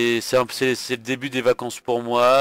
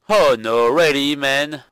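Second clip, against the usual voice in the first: put-on English with a French tinge; showy English accent; 2 semitones higher, 5 dB louder, slower than usual. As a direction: exclaim loudly in hammed-up English accent. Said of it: "admirer mon magnifique accent anglais".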